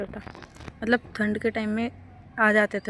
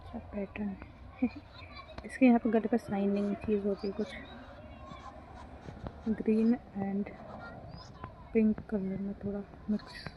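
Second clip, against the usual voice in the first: whispering; 9 dB quieter than usual. Real, not acted. Hushed near the pigeon.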